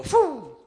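high, loud mock crash sound; crash noise. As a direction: burst out the noise of flying over the bars. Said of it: "and you go".

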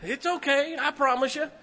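high, laughing, softened reassurance; gentle soothing tone. Retold or imitated. Father soothing his startled daughter, high and gentle. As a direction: coax her calm, half laughing. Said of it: "I gotta use a different tone of voice".

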